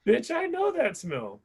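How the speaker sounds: high, slow hammed-up voice; mock realization. Husband realizing what his wife's mouth smells like, high and slow. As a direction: play a sudden gross realization. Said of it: "and I was like".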